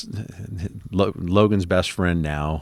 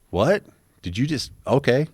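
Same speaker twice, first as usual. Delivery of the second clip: higher-pitched, questioning "what?"; taken aback; 7 semitones higher than usual. Retold or imitated. Couple reacting to a sudden idea of moving to Utah, taken aback. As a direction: caught off guard, halting mid-question.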